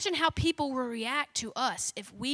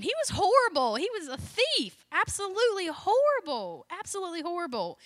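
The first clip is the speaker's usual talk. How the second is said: emphatic disgust; 5 semitones higher than usual. Real, not acted. Condemning the tax man.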